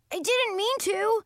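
very high-pitched defensive protest; upset boy. A narrator's reading. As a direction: protest innocence in a high child's voice. Child apologises amid yelling fight.

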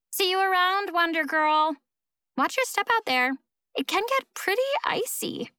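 high, lilting mock-friendly taunt; singsong parting jab; taunting farewell. Rival's taunting parting shot.

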